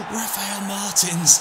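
excited save commentary; gasping save call. Real, not acted. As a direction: react to the save with a sharp gasp. Near-goal save.

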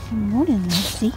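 normal talking voice

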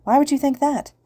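high-pitched disbelief; suddenly awake. A narrator's reading. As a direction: question it, suddenly wide awake. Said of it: "suddenly more awake".

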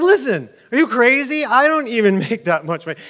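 high, loud mock indignation; acted outrage with a laugh slipping in; mad. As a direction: play the exasperated, offended messenger. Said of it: "Now he's mad".

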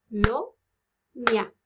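"Colonia" slowly split into co, lo, nia; "Colonia" in three slow, separate beats; syllables with claps. Shown as a demonstration. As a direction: say each syllable of "Colonia" apart, as if clapping.

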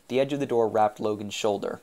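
plain reading voice

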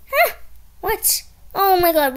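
ordinary talk

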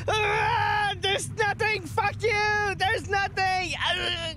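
high, panicked defiant shouting; frantic high-pitched cry; terrified bravado. Real, not acted. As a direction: yell it to ward off fear. Charging in while terrified, cursing.

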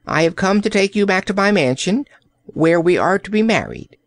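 put-on high, quick old courtier; crying out. A narrator's reading. Old courtier claiming the princess as bride, crying out. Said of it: "he cried".

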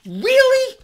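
high, loud incredulous question; exaggerated astonishment; mock disbelief. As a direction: shoot the pitch right up in disbelief. Mock disbelief at booming economy.

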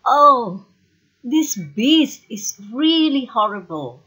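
high, horrified reaction; terrified. A narrator's reading. Beauty seeing the beast's ugly face, terrified. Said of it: "she's terrified".

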